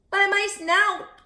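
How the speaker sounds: high, loud, slow alarmed cry; frightened cry. Acted. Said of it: "cried Horace".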